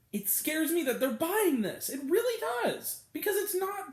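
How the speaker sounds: high-pitched, emphatic outrage; impassioned rant. Real, not acted. Reviewer condemning the film's fantasy to viewers, impassioned rant. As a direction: argue it urgently, pitched well up. Real rant against the film.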